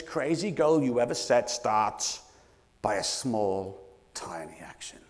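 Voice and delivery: fading to a whisper. Real, not acted. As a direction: let the voice sink to a whisper. Building to a whispered point.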